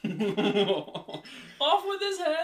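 slow, high, laughing royal command; roaring tyrant king. Pretend. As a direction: bellow it like a mad queen. Mock royal execution order.

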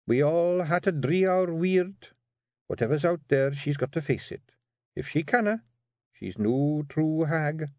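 high, measured verdict, slowing at the end; firm Scottish Kelda. Acted. Jeanie says the hag must face it, firmly.

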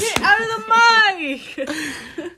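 high, laughing yell; laughing shout. Real, not acted. Yelling at friend, laughing.

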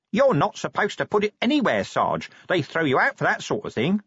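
high-pitched cheeky underling; knowing corporal. Acted. Corporal advising sergeant about club rules, knowing. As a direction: point it out in a high voice. Figure of Nobby answers.

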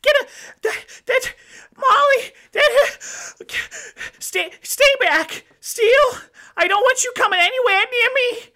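high, loud terrified scream; Stacy screaming in panic; screaming in terror. Acted. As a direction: shriek at him to stay back. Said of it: "as she's screaming".

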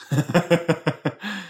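high staccato chuckle; rapid laugh. Real, not acted. Joking she is the character, rapid high laugh. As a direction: rattle off a quick laugh. Laughs after claiming to be her.

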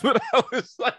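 laughing through the words; giggling retelling. Real, not acted. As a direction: laugh it out while confessing.

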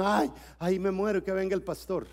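usual speaking voice

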